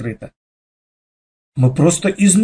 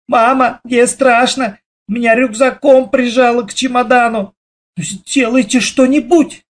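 frightened shouting; 8 semitones higher than usual. A narrator's reading. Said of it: "закричала мама".

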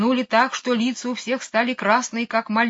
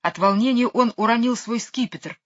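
ordinary delivery

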